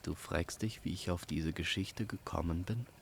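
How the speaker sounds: ordinary delivery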